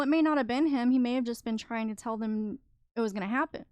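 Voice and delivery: ordinary delivery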